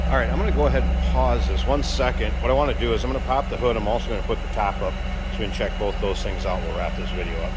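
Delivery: loud, projecting over the running engine and stereo. Real, not acted. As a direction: push your voice over the motor.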